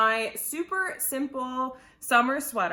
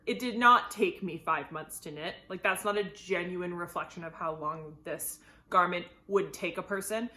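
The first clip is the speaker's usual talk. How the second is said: normal talking voice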